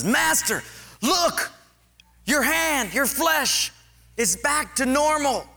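excited servant. Retold or imitated. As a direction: point at the healed hand and cry out. Servant sees healed flesh.

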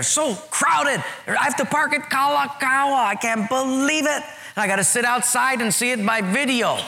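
high-pitched churchgoer's moan, building; upset complaining. Acted. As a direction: act out a petty, exasperated complaint. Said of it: "we'll get a little upset".

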